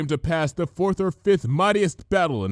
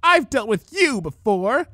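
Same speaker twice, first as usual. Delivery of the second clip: high, slow, louder scornful scoff; mock Dash with disdain; 11 semitones higher, 7 dB louder, slower than usual. A narrator's reading. Changeling scoffing at Rainbow Dash. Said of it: "the changeling scoffed".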